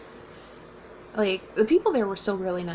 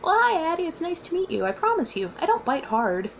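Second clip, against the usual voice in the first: higher, kindly reassuring hello; light teasing welcome, voiced higher; friendly author; 9 semitones higher than usual. Author greeting a nervous fan, higher, kindly teasing. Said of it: "he laughs and goes".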